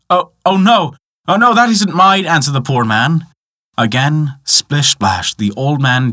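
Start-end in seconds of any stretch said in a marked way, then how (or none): 0.1-2.2 high, protesting voice; acted dismayed refusal. A narrator's reading. Poor man refuses the golden axe, high protest. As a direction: protest it, pitched well up.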